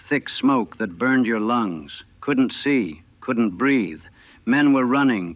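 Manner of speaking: usual speaking voice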